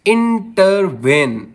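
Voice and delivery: Intervene slowly in syllables, weight on वेन; stress on last syllable. Shown as a demonstration. Teacher giving an anapest example, slow stress on वेन in intervene. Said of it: "मैं इसको कैसे बोल रहा हूँ".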